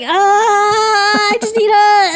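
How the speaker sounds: high, loud panicked gasp of words; panicked cry. Retold or imitated. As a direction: blurt it out in sudden panic. Retells panicked moment, loud.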